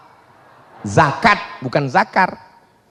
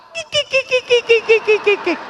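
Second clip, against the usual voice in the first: high-pitched mock giggle; tawa tiruan. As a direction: cackle in quick squeaky bursts. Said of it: "ibu jawab dengan ketawa". (cekikikan ibu yang dibuat-buat)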